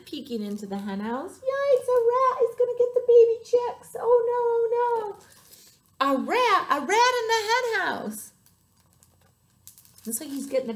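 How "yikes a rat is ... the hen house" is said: very high, loud hen alarm; panicked squawking. A narrator's reading. Hen fears for the baby chicks, high panicked squawk. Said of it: "squawked a hen".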